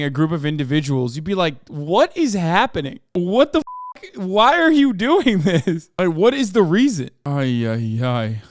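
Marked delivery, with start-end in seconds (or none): 1.7-7.1 drawn-out, high, bewildered questions; slow, incredulous mock outcry; exasperated disbelief. Acts out an onlooker's exasperated disbelief. 7.3-8.4 low, exasperated "ay ay ay"; low-pitched, fed-up sigh of words; weary dismay. Real, not acted. Dismayed groan after the rant.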